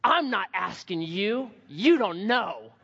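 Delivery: put-on slow, dismissive kid voice; a small boy brushing him off, drawn out; boy walking past the snack dad, slow and dismissive; little boy. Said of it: "he goes".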